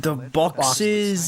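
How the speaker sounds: put-on British accent. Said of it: "not allowed to do a British accent".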